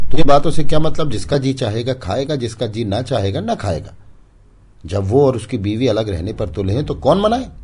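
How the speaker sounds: put-on high, very loud rebuke to the boy; loud, bitter aunt scolding a child; sharp scolding. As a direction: cut him down, fed up. Panna scolding the child harshly.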